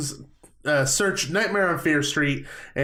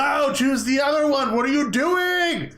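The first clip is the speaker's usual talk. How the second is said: high-pitched mock yell, last words drawn out; retold yelling; 10 semitones higher, slower than usual. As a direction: act out yelling at the radio. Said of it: "found myself yelling at the radio".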